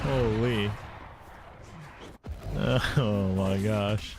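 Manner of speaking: very slow drawn-out amazement; stunned. Real, not acted. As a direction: stretch it out in disbelief. Stunned by the play.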